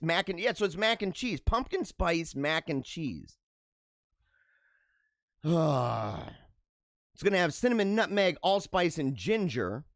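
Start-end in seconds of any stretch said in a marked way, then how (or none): 5.4-6.3 low, open-mouthed sigh; long sigh. Real, not acted. Host reacting to pumpkin spice mac and cheese, sighing. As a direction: let out one long, low breath.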